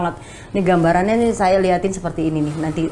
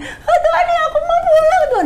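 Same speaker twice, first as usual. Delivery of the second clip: put-on high childlike plea with a laugh; child voice begging God, half laughing; like a small child, laughing. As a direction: laugh through a scared kid's plea. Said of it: "Kayak anak kecil".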